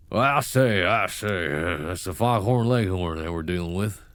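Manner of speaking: full-on southern accent, loud opening; hammed-up southern cartoon rooster voice; Foghorn Leghorn southern accent. Shown as a demonstration. Demonstrating a full-on southern accent for a viewer, hammed-up. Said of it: "full-on southern accent".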